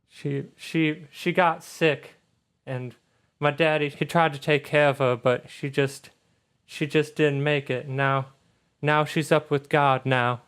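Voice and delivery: a touch choked up, with pauses; tearing up. Acted. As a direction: falter gently through a painful loss. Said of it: "she starts tearing up a little bit".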